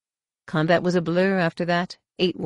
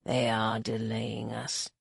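slow, low, hushed warning; soft low voice, drawn out; quiet warning; 6 semitones lower, 9 dB quieter, slower than usual. A narrator's reading. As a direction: warn an ally under your breath. Wounded tracker quietly warns ally.

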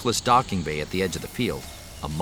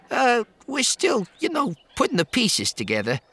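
put-on high young man voice; 3 dB louder than usual. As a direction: stall nervously in a high voice. Post voiced, hesitant.

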